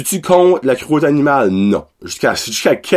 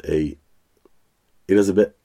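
normal talking voice

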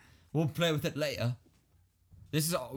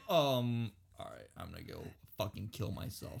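much lower, very soft retreat; deflated mumble; 6 semitones lower, 7 dB quieter than usual. Retold or imitated. Dude rejected by the girl, deflated. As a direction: shrink away, quiet and defeated. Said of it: "And the dude's like".

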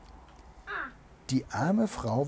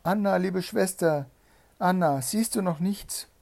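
high, raised call to a sister; 7 semitones higher, 5 dB louder than usual. A narrator's reading. Troubled wife calls to Anna on the tower, calling out. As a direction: call up anxiously, pitched high and louder. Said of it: "die arme Betrübte rief ihr von Zeit zu Zeit zu".

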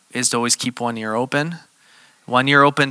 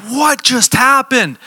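stunned question; 12 semitones higher, 4 dB louder, slower than usual. Retold or imitated. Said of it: "freaking out".

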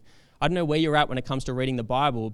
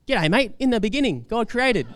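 higher, hammed-up broad Australian; Ocker Aussie accent; 6 semitones higher than usual. Preacher joking about app voices he avoids, Ocker Aussie accent. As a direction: lay the Ocker Australian twang on thick. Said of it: "not some Ocker Aussie voice".

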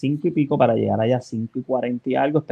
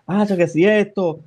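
excited; 6 semitones higher than usual. Retold or imitated. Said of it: "yo bien emocionado".

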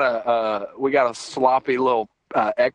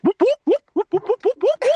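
siren imitation. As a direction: whoop like a cop siren.

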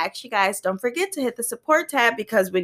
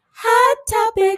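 high, drawn-out title call; segment announcement; 10 semitones higher, 3 dB louder, slower than usual. Pretend. Host heats it up with hot topics, drawn-out high call. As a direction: sing out the segment name.

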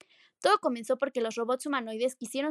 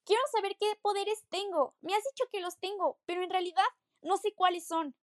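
urgent plea; 6 semitones higher, 4 dB louder than usual. Acted. Daughter urgently asks father.

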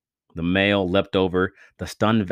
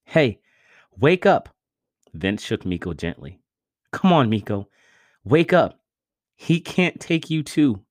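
desperate pleading; 6 semitones higher than usual. A narrator's reading. Vince shaking Miko awake, tearful and pleading. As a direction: beg him to wake up.

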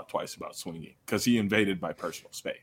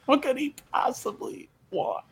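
squeaky, much higher, in disbelief; mock high incredulous voice. Retelling his own reaction to the guy coming back, in a high mocking voice. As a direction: pitch way up like you can't believe it.

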